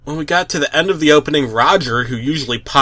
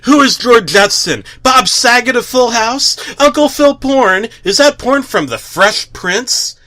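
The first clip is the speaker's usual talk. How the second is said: panicked shriek; 8 semitones higher, 4 dB louder than usual. Retold or imitated. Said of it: "I shrieked".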